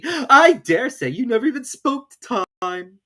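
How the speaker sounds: high, loud excitable ramble; Hatter. A narrator's reading. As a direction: boast about Time. Hatter's long speech.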